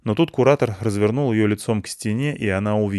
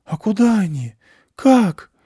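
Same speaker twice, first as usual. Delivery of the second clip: bewildered question; 12 semitones higher, 5 dB louder, slower than usual. Acted. Miguel confused.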